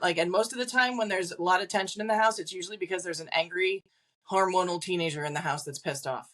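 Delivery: ordinary talk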